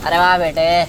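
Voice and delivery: loud, projected Jaani-style praise; loud mock-heroic Jaani delivery; Jaani-type film dialogue. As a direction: boom it out like a filmi Jaani. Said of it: "थोड़ा जानी, जानी".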